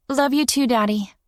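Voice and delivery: put-on high sweet child's voice; a little girl saying goodnight; young girl voice. Child voice in story.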